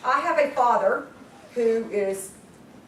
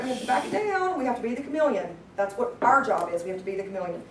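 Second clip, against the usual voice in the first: ordinary talk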